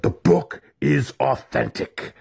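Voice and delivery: slow, high-pitched angry insistence; roared. A narrator's reading. Wizard insists to his student, roaring slowly. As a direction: roar each word out emphatically. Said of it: "Alton roared".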